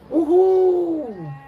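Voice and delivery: high, loud whooping cheer. Real, not acted. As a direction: let out a big party whoop.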